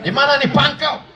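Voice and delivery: firm, high, no-nonsense question. Shown as a demonstration. Showing mothers how to talk on the phone, firm. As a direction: ask it briskly, with no sweetness. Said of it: "harus tegas".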